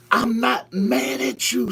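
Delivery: high, drawn-out pronouncement; lifted, stretched pulpit voice; prophetic preaching. Acted. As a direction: proclaim it slowly as God's own word. Speaks as God to believers.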